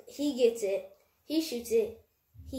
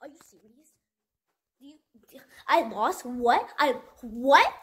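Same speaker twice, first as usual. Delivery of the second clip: imitating an angry sore loser; 3 semitones higher, 6 dB louder than usual. Mock rant of a sore loser who can't take losing. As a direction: play the kid who just lost, going off in shock. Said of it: "he was going like this".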